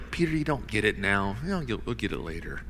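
understated, said softly as if withholding something; cryptic reply. Retold or imitated. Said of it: "Jesus kind of cryptically responds".

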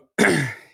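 loud throat clear. Real, not acted. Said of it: "I will be coughing probably".

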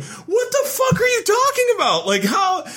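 high disbelieving shout; incredulous shout. Real, not acted. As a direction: yell in disbelief at the plot. Ranting at the prince's line.

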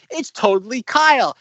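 imitating the show's announcer; a hint of a TV announcer calling the title. Imitating the announcer introducing the show. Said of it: "you hear the announcer".

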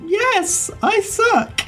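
put-on very high girlish voice; sheepish teen girl. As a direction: squeak it out sheepishly. GM voices player character.

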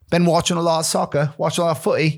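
put-on British slang voice; British accent. Showing off British slang about soccer, British accent. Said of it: "use British accent with British slang".

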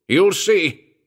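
loud, high roar. A narrator's reading. Captain roaring after the departing doctor, loud roar. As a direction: bellow it as a threat. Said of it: "roared the captain".

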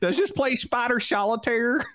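put-on high old lady voice; teasing grandma impression. Joining the grandma bit.

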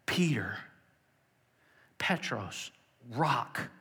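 breathy whisper of the name. Retold or imitated. Jesus giving Simon his new name in a whisper. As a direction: hush it like a secret.

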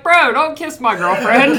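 laughing, loud, slow mock outrage; half-laughing playful protest. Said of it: "I'm like".

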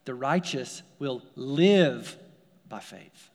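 the word "live" held long and leaned on, slow; slow it down and land hard on "live"; stress on live. Shown as a demonstration. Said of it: "Now I want to emphasize the word "live".

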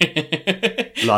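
drawn-out la-di-da; sing-song mock. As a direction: lazily sing it out.

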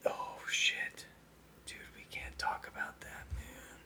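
panicked whisper. Real, not acted. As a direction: hiss it under your breath. Panicked hush about secret.

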